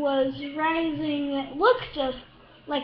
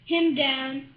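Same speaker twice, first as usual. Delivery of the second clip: plain reading voice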